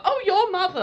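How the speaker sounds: high, loud mock Australian outburst; Australian sitcom quote. Friends quoting an Australian sitcom, mock Australian. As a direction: do the Kath and Kim line in Australian. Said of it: "reference to Kath and Kim".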